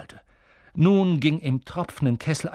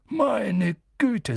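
staunender Wirt; 7 semitones higher than usual. Acted. Wirt staunt über Harry.

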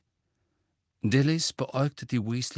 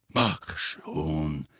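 low Moody growl, much deeper; growled command; 8 semitones lower than usual. A narrator's reading. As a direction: growl it low at someone to get moving. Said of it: "knurrte Moody". (tief geknurrt)

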